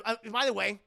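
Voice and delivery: ordinary talk, nothing marked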